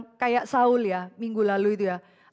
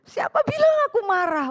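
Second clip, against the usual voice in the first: high, louder huffy denial; mock indignant denial; 13 semitones higher, 5 dB louder than usual. Wife snaps back at her husband, mock indignant and high. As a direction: deny being angry while clearly fuming. Wife denying anger, acted.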